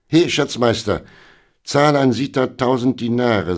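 hearty royal call. A narrator's reading. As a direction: summon the treasurer loudly. Sultan calls out to treasurer.

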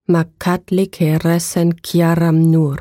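slow, low, hoarse ancient incantation; hoarse eerie voice. Acted. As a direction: intone it like an ancient being. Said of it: "Eine heisere Stimme wiederholte".

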